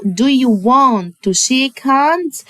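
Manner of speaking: teacher drawing out the question; slow question model. Shown as a demonstration. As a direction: Do you want to shake hands said slowly. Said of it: "Do هنا معناها هل".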